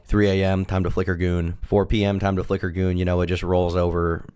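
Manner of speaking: normal talking voice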